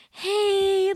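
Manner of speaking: lightly voiced caller; boss on the phone. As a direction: ease in with a high greeting. Boss phoning, voiced by the teller.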